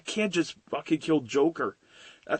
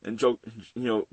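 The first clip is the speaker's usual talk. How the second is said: ordinary talk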